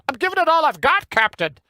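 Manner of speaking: put-on high-pitched Scottish accent retried; second try at Scotty's Scottish accent; Scotty impression again. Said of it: "Nah, that was no better".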